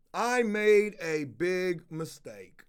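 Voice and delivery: retold confession, each word spaced out; slow mock confession. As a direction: imitate a friend owning up, very slowly. Quotes friend's reluctant confession.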